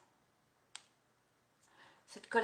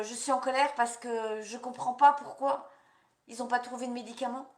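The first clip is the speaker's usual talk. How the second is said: raised, indignant declaration; demonstrated anger; 3 semitones higher, 4 dB louder than usual. Shown as a demonstration. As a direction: voice the anger out loud. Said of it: "dites pourquoi vous êtes en colère".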